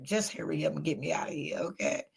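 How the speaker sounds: hushed whisper, almost no voice; whispered under breath at the machine; whispered plea. Retold or imitated. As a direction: whisper it like you want out fast. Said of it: "I be like".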